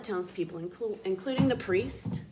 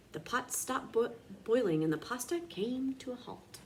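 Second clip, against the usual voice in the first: usual speaking voice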